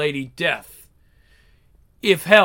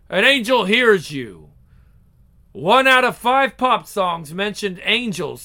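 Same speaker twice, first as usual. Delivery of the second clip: ordinary delivery, nothing marked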